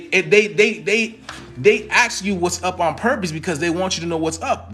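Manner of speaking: usual speaking voice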